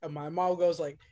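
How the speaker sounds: normal talking voice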